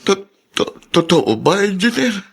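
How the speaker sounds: high, stunned stammer; overwhelmed, grateful disbelief; disbelieving stammer. Acted. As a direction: stumble over it in amazement. Offered help, cannot believe it.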